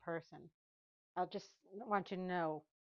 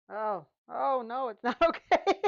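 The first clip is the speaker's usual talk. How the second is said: loud, high flustered yelp with laughter; startled cry over a mistake; panicked oh no; 4 semitones higher, 12 dB louder than usual. Real, not acted. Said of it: "you hear me talking to myself".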